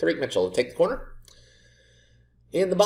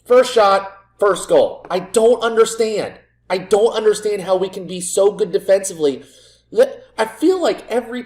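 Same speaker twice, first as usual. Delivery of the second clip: loud, higher ranting disbelief; exasperated rant; 5 semitones higher, 6 dB louder than usual. Real, not acted. Coach ranting at his side's leaky defence. Team concedes from the first shot.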